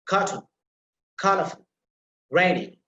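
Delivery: cartoon, colorful, rainy, each punched on its first syllable; word list with CAR, COL and RAI stressed. Shown as a demonstration. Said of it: "put emphasis on the first syllable".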